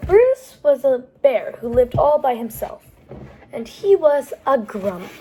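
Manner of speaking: lively, lilting storytime read; picture-book narration; reading to children. A narrator's reading. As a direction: tell it to a group of small kids. Reads a picture book aloud.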